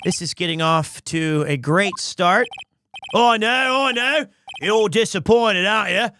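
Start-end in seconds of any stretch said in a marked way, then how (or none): 3.1-6.1 hammed-up Aussie, starting high; Australian accent. Aussie actor addressing disappointed fans, accented and resigned. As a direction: play it as a put-on Australian. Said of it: "Aussie it is".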